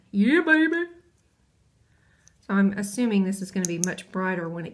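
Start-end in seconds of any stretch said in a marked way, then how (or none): 0.1-0.9 put-on sassy yeah baby. Sassy reaction to Hot Thang.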